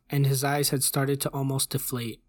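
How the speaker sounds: usual speaking voice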